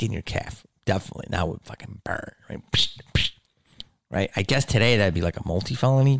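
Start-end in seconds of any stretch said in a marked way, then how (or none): 2.7-3.3 imitated swish of a stick; sharp unvoiced "psh", twice; whooshing stick noise. Storyteller mimics a stick hitting a calf, swishing noise.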